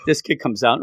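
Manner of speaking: ordinary talk, nothing marked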